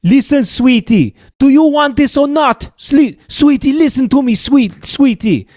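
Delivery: high, loud mock exasperated scammer; hammed-up irritated caller pleading sweetie; upset scammer. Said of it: "he was getting so upset with us".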